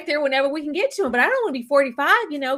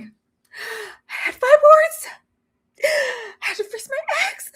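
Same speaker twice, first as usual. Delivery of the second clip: mocking her intro; 12 semitones higher than usual. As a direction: stretch it out high and silly. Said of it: "I'm like".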